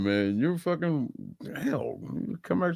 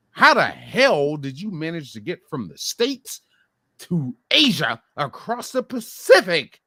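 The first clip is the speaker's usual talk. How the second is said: building rant; 10 semitones higher than usual. Real, not acted. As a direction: pitch climbing higher and higher in disbelief. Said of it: "I was really going off on a rant".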